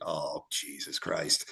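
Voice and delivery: low, soft, slow groan of disbelief; exasperated mutter. Retold or imitated. Player reacts to the screaming coach, low weary mutter. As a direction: mutter it under your breath, fed up. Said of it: "he looked at you like".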